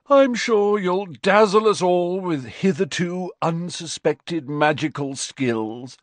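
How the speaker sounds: high, drawn-out bored yawn through the words. A narrator's reading. Fred scoffing at Ron's boast, yawning. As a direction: stretch it out through a mock yawn, high-pitched. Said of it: "yawned Fred".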